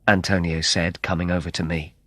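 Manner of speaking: ordinary talk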